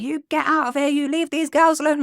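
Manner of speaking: a hint of an elderly lady chasing off a stranger; mock old lady yelling. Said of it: "she's like".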